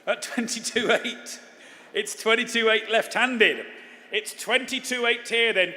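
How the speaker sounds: bright, punchy auction calling; auctioneer. Acted. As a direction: sell it to the room, bid by bid.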